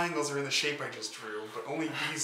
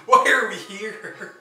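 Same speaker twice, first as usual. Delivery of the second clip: laughing; 10 semitones higher, 12 dB louder, much slower than usual. Real, not acted. Laughing absurd question to cohost.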